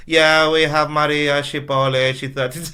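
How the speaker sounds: indifferent receptionist. Retold or imitated. Bored receptionist answers prank call.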